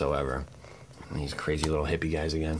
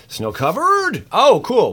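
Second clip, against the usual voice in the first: excited yell; 14 semitones higher, 10 dB louder, slower than usual. Real, not acted. Excited pack pull.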